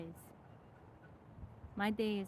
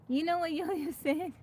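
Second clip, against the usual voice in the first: laughing; 9 semitones higher, 6 dB louder than usual. Real, not acted. Amused, setting up friend's remark.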